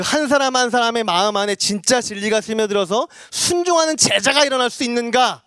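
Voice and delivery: impassioned preaching. Real, not acted. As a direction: pitch climbing into a forceful pulpit call. Preaches at raised force.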